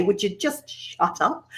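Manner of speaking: soft, slow scolding; muttering to herself. Retold or imitated. Presenter quoting her self-talk, soft and slow. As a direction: hush yourself, half amused. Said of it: "I still like to say that to myself".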